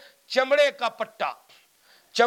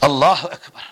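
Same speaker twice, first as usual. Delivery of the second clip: awed outcry; 9 semitones lower, 10 dB louder than usual. Real, not acted.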